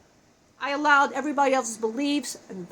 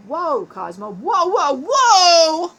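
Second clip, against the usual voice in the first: alarmed editor building to a shout; 4 semitones higher, 9 dB louder, much slower than usual. Retold or imitated. Editor Angie reacting to his footage, building to a shout. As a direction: escalate from a calm whoa to a panicked shout. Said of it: "Angie's gonna be editing, going".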